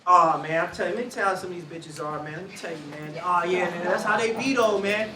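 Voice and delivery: demeaning gossip. Shown as a demonstration. Speaker showing oppressive talk, low and dismissive. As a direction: run people down casually. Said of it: "the ways in which oppression frames them".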